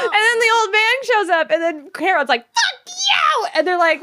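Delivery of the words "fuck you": very high, comic screech of abuse; high-pitched pretend fury; mock yelling. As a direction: yell it in hammed-up outrage. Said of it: "why are you yelling at this".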